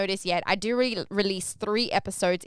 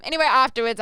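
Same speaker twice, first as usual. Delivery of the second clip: normal talking voice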